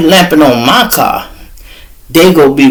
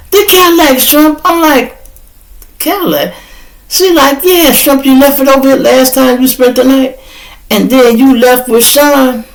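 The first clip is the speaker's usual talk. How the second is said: voicing girlfriend; 11 semitones higher than usual. Girlfriend Kelly voiced.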